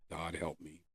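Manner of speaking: a broken prayer pushed out through tears; emotional plea. Retold or imitated. A drunk man alone begs God for help, choked and hushed. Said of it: "I said it with way more emotion than that".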